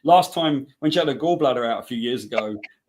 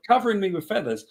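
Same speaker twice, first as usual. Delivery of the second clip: normal talking voice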